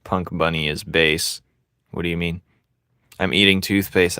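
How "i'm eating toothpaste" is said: normal talking voice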